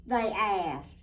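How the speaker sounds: put-on lively storybook narration for little girls; reading to children. A narrator's reading. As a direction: read it like a bedtime picture book. Picture book read aloud.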